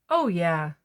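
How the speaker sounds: plain reading voice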